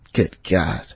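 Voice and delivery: usual speaking voice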